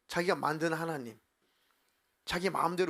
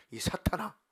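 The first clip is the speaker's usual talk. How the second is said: sudden pitched-up rebuke; acted voice of God scolding; God's inner rebuke; 4 semitones higher than usual. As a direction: cut in with a stern accusation. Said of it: "갑자기 하나님께서 마음속에 음성으로".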